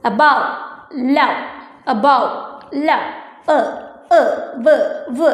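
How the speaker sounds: above and love in separate beats, then the end sounds എ, വ് alone; demonstrated word endings. Shown as a demonstration. Teacher showing pupils where the words stop, demonstrated endings. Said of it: "നിർത്തുന്നത് കണ്ടോ".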